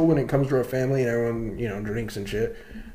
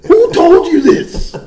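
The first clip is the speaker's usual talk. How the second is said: slow, high, loud yell; outraged demand; 13 semitones higher, 9 dB louder, slower than usual. Retold or imitated. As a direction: drag out the shouted demand.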